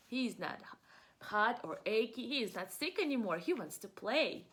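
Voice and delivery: ordinary delivery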